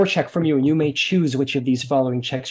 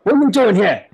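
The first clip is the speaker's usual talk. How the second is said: louder, higher guard challenge; suspicious guards; 8 semitones higher, 6 dB louder than usual. Acted. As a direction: call out like a wary watchman. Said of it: "They go".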